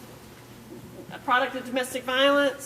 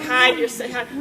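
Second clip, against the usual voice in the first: ordinary talk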